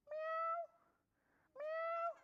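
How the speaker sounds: high-pitched little cat mew; cat meow. Acted. Puppy meows like a cat.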